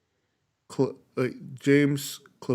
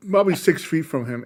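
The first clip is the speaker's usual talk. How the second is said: ordinary delivery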